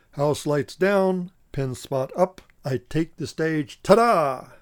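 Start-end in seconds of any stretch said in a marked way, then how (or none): 3.8-4.5 loud, high flourish of a reveal; showman's ta-da. Acted. Clown taking the stage with a showy flourish. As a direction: present yourself like a circus act.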